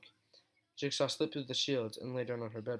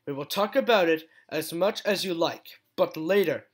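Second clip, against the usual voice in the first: louder and higher, pressing; urgent, raised voice; 4 semitones higher, 8 dB louder than usual. Acted. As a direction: insist firmly, no arguing now. Urgent after stomping hoof.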